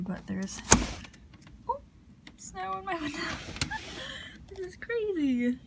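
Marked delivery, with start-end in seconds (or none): 1.7-5.6 high, laughing surprise; amazed and laughing at the snow; surprised. Real, not acted. Snow blowing in her window.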